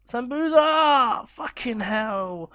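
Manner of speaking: high, slow, stretched wail of the name then curse; anguished cry. Real, not acted. Gamer wailing at a missed chance. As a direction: let the miss tear the words out slowly.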